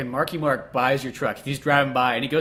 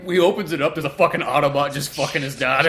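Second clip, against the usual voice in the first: normal talking voice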